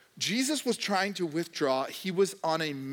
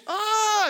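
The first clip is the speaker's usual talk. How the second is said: much higher, louder exasperated cry; frustrated yell; 8 dB louder than usual. Retold or imitated. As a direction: burst out in mock frustration. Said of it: "Like, you're just".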